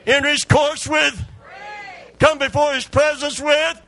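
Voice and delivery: high, loud preaching to the congregation; full-force preaching. Acted. Preacher rousing the church to praise, loud and high. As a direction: build the crowd up and drive it home.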